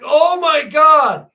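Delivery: high, loud, drawn-out terrified shout; retold scream. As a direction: scream it out in panic. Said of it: "he fucking screams".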